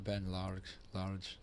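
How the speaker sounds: poor, softly said Scottish accent; attempted Scottish accent. Shown as a demonstration. Commentator testing the surfer's name in an attempted Scottish accent. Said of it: "if I say it in a Scottish accent".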